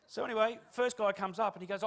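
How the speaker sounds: ordinary delivery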